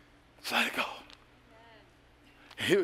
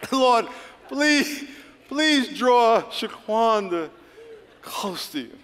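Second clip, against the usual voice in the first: higher, stretched pleading; mock plea; 6 semitones higher, much slower than usual. Praying for Shaquanda, mock pleading. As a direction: beg it out slowly.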